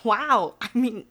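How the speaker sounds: stammered, flattered amazement; awestruck. Acted. Programmer hearing Mister Birch read her blog, awestruck. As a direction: stumble over it, stunned by the praise.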